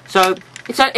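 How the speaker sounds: usual speaking voice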